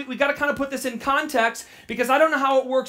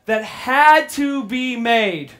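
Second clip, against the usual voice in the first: slow and louder, leaning on "had"; stress on had; 5 dB louder, much slower than usual. Shown as a demonstration. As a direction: lead the congregation, pressing "had" hard. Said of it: "Would you say that phrase with me".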